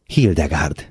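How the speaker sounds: ordinary delivery, nothing marked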